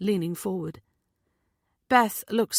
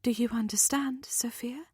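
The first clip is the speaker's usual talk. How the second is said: soft, higher near-whisper question; gentle; 5 semitones higher, 4 dB quieter than usual. A narrator's reading. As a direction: ask gently, almost whispering. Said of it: "Beth says gently".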